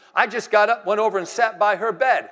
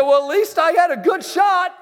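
sheepish; 10 semitones higher, 6 dB louder than usual. Retold or imitated. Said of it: "And I said".

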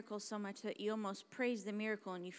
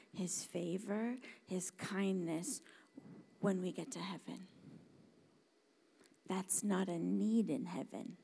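ordinary talk

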